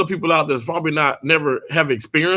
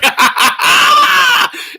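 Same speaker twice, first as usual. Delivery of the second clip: a high, loud burst of laughter; shrieking laugh; 7 dB louder than usual. Real, not acted. Streamer laughs hard at Baby Sarah's trigger finger joke, loud high shriek of laughter.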